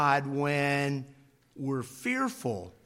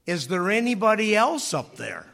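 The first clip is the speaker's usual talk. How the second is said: loud, higher call upward; 6 semitones higher, 6 dB louder than usual. Retold or imitated. Man on cliff calling past God, loud. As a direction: shout the question up the cliff.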